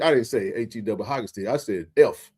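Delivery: usual speaking voice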